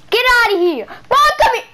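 yelling at pet. Real, not acted. Gamer shouting at the animal named Button. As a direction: holler at the animal to come.

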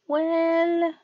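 high, loud strained brightness; a frightened wife masking it; forced bright reply. Acted. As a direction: answer through a frozen smile. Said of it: "she answered with a frozen smile".

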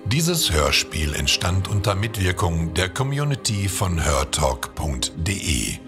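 usual speaking voice

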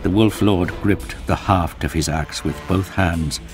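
ordinary delivery

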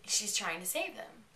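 ordinary delivery

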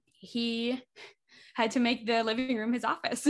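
ordinary delivery

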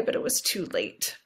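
retold words fading to a whisper; mother retelling her daughter's words, voice breaking into a whisper.

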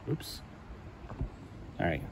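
normal talking voice

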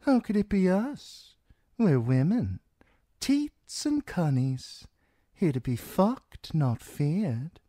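put-on very high accented woman's voice; amused washerwoman. A narrator's reading. Holly laughs off Theon's accusation, scoffing high voice. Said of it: "Holly laughed".

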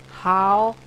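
word by word, long pauses; mock slow speech. Gamer asking how you speak chicken, mock slow speech. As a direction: spell it out one halting word at a time.

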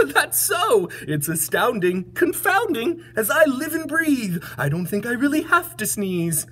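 high, delighted elephant voice; amazed elephant. Acted. Elephant finds he needn't sneeze, amused amazement. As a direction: marvel with laughter in the voice. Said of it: "laughed the elephant".

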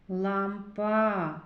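"Лампа" with a long final "а"; final vowel stretched. Shown as a demonstration. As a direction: hold the last A of "Лампа". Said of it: "Зробимо звуковий аналіз слова".

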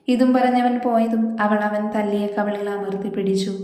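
plain reading voice

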